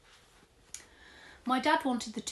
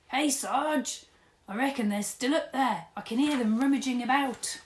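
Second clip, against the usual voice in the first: loud, higher call up to the sergeant; 7 semitones higher, 8 dB louder than usual. Acted. Constable calling the sergeant from the stairs. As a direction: call to him across the house.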